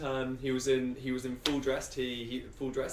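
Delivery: ordinary delivery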